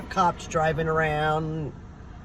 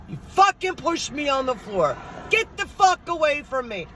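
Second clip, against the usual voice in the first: high-pitched angry rant; dad yelling angrily; 8 semitones higher than usual. Retold or imitated. Dad cursing the one who pushed him, imitated angry yell. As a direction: imitate dad raging at whoever shoved him. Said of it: "you hear my dad screaming".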